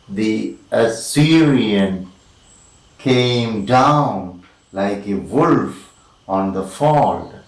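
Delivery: slow scansion, stretching SYR in "Assyrian", then "down", "wolf", "fold"; measured anapaestic beat on "Assyrian came down"; metrical verse reading. Shown as a demonstration. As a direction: lean long on every third syllable up to "fold". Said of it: "two unstressed followed by one stressed".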